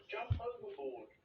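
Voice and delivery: high, slow, loud cry; urgent shout. A narrator's reading. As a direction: yell the order in alarm.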